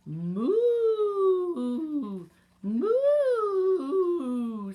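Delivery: long, loud, high imitated moo; cow moo. A narrator's reading. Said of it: "said the cow".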